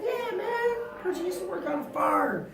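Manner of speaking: high, eager factory owner greeting a worker; boss voice. Retold or imitated. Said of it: "He's like".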